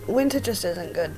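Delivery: normal talking voice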